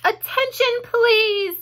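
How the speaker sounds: high, loud call for attention; ringing, formal call to order; loud, firm voice. A narrator's reading. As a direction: announce it loudly and firmly. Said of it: "he said in a loud, firm voice".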